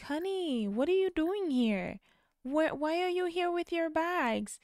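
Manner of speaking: high, motherly mom voice; voicing a worried mother. Narrator voicing the mom questioning her son, high.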